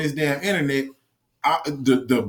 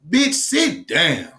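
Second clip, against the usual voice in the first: slow dismissive put-down; much slower than usual. Real, not acted. Ranting at Biden's statement.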